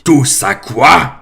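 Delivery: the giant roars the question back in outrage; thundering. A narrator's reading. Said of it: "tonna Hagrid".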